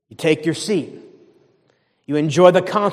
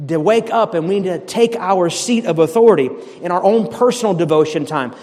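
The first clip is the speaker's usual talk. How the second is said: usual speaking voice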